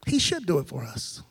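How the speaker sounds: mock the comfortable churchgoer; a hint of smug entitlement; complacent mock. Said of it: "we're kind of like".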